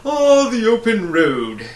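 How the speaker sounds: loud, high, slow bug voice; pompous humbug exclaiming. A narrator's reading. Humbug exclaims at the open road, pompous and loud. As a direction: boom it out like a grand little bug. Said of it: "exclaimed the humbug".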